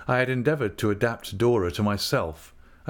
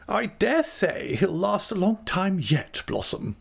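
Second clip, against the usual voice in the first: high, slow comforting voice; reassuring aunt; 10 semitones higher than usual. A narrator's reading. Aunt patting Dora's cheek about the dog, reassuring.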